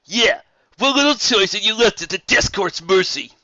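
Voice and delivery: high, loud hostile voice; glaring accusation. Acted. Soren glaring at Rainbow Dash, loud hostile voice. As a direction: throw the blame at her.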